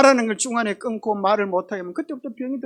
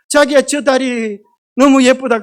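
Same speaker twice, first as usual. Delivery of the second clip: louder, slowed, cooing call to her husband; wife's voice, louder; 3 semitones higher, 8 dB louder than usual. Shown as a demonstration. Wife gushing over the bridge to her Gyeongsang husband. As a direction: point out the bridge dreamily. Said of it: "경상도 남자 보고".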